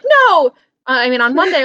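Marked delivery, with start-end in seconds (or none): 0.0-0.5 high, loud mock wail of dismay; hammed-up loud no. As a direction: cry out no in mock despair. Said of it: "I was like".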